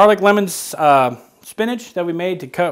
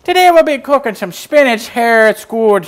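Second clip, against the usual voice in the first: put-on higher, louder Irish accent; 7 semitones higher, 7 dB louder than usual. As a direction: keep going in an Irish accent. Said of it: "An Irish accent".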